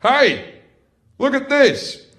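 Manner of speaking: cocky gunner's holler; gunner calling out. Acted. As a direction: shout for attention, showing off. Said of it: "And then he went".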